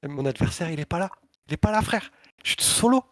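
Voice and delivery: mounting disbelief at being alone; building panic. Retold or imitated. Panique, adversaire absent. (panique qui monte, de plus en plus haut et fort)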